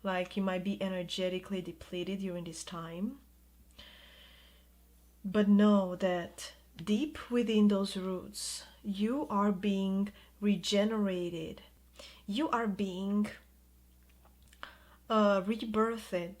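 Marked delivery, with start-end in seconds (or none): 5.2-16.4 slow down to let it sink in; slow and emphatic. Real, not acted. Tarot reader slowly reassuring the viewer about rebirth. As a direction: stretch each word slowly, deliberately.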